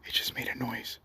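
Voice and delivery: hushed whisper, voice barely there. Real, not acted. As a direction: whisper so nothing hears you. Heard noise in dark forest.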